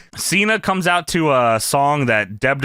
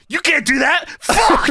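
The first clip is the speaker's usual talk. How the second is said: high-pitched outburst, climbing at the end; hammed-up wrestler's tantrum; mock yell; 10 semitones higher, slower than usual. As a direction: yell it in pretend outrage. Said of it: "and he yells".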